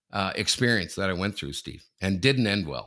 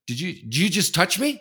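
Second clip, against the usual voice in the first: indignant challenge; 9 semitones higher, 3 dB louder than usual. Retold or imitated. Man confronts him, retold.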